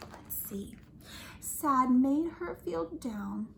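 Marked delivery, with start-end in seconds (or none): 0.1-0.7 whisper. Acted. Hushed aside.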